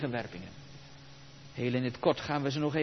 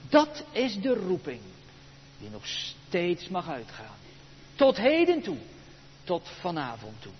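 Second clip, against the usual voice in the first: ordinary talk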